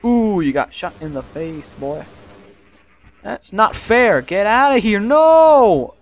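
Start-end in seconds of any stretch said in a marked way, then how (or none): 3.2-5.9 high, loud protest building to a yell; frustrated shout. Real, not acted. Gamer protesting an unfair hit in game, frustrated shouting. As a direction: cry foul at the top of your voice. Game goes against the player.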